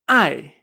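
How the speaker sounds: "Ai" loud, falling sharply; dry angry fourth tone. Shown as a demonstration. Teacher showing the fourth tone, "Ai" dry, loud and falling. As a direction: snap "Ai" downward as if cross. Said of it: "es un tono seco".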